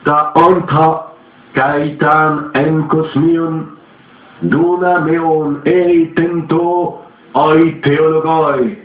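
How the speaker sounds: slow, high intoned chant; eerie incantation. A narrator's reading. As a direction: intone it like a voice from beyond. Spirit intones Greek formula.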